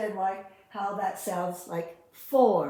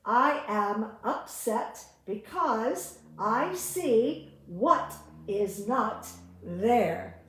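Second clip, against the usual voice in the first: slow reading; much slower than usual. Shown as a demonstration. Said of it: "Lesson number six".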